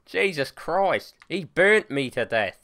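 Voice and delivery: usual speaking voice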